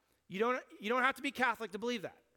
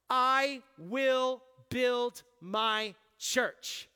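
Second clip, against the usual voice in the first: "I will build my church" said very slowly, a touch louder; slow, weighty emphasis; 4 dB louder, much slower than usual. Shown as a demonstration. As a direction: let each word of "I will build my church" land on its own. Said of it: "It has everything to do with the first part".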